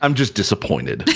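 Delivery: a hint of a let-down father; lightly voiced dad who's not mad, just disappointed. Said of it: "disappointed dad, uh, voice".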